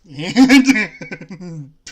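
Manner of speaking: sudden loud burst of laughter. Real, not acted. Cracking up at "you can have whatever you want", loud laugh. Laughs at the friend's odd line.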